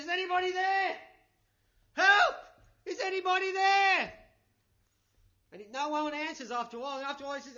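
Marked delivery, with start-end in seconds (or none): 0.0-4.1 loud, high desperate shout; crying out for help. Acted. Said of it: "he's crying out".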